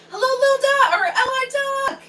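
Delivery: squealed high, loud hello; excited greeting. Real, not acted. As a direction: greet a friend with delight. Greeting a chat member.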